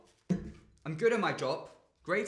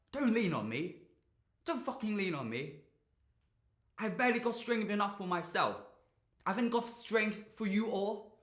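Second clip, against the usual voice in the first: higher-pitched, overwhelmed protest; frustrated, at the end of one's strength; strained plea; 5 semitones higher than usual. Acted. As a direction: plead that you can't carry them. Pleading with family not to lean.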